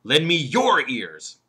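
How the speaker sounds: "your" hit loud and stretched out; stress on your. Shown as a demonstration. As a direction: punch "your", slowing the phrase. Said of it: "What about the third word".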